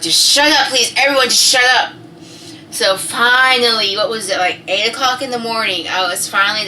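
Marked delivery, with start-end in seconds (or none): 0.0-1.9 loud, higher exasperated plea; annoyed. Retold or imitated. Woman in labor to everyone talking, annoyed. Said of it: "just so fucking annoying".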